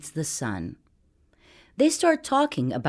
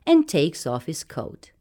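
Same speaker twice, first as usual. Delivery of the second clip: usual speaking voice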